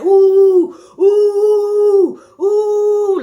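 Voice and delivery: loud high crowd ooh. Retold or imitated. Classmates watching the fight, oohing high and loud. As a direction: wail it like the whole class watching. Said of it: "everybody like".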